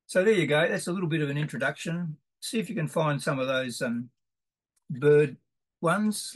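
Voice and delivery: usual speaking voice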